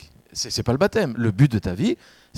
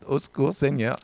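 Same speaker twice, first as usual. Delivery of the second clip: a lightly hammed-up cry for help; mock panicked plea. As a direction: play the embarrassed baptized one, half joking. Mock plea while soaked in water.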